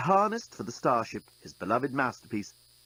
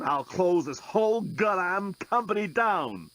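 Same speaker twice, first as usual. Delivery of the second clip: higher, slow, threatening; angry threat; 7 semitones higher, slower than usual. A narrator's reading. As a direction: spell out the threat to shut them down. Dan threatens the desk bot.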